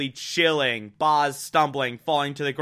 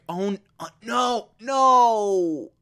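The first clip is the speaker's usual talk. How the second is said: higher, panicked no, no, Boz, Boz; imitating horror; 7 semitones higher, much slower than usual. Viewer reliving the scene, mock horrified cry for Boz. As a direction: voice rising in mock dread over Boz. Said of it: "really terrifying moment where you think".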